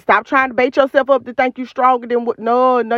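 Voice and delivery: ordinary talk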